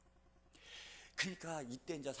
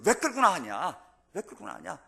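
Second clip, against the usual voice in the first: loud, high mock protest, then easing off; indignant protest; 7 semitones higher, 12 dB louder than usual. Preacher acting out the people protesting to Moses, indignant. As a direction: hurl the question as an accusation. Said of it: "항의를 하는 거예요".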